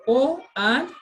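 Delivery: "O" open and oral. Shown as a demonstration. Teacher contrasting O with on for students. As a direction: clear O, no nasal. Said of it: "There's a difference between O and on".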